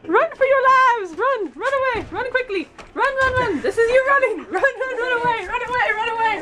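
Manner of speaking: high panicked yelling while fleeing; yelling while running away. Pretend. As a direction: yell run away in mock panic. Fleeing the pretend monster.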